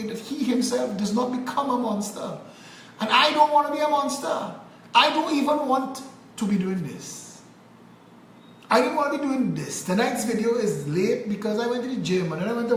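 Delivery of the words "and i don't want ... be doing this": raised pitch, emphatic refusal; exasperated protest. Real, not acted. Telling viewers he never wanted this, exasperated protest. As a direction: protest it high and insistently. Protesting his own reluctant role.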